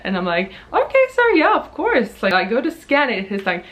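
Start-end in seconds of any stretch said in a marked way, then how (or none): 0.7-2.2 much higher, chirpy politeness; sweetly accommodating shop assistant; high, bright overpolite reply; customer service voice. Retold or imitated. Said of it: "my normal customer service self".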